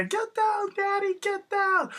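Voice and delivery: very high, drawn-out mock cheer; high-pitched retold whooping encouragement; cheering her on. Imitating friends cheering on dancing.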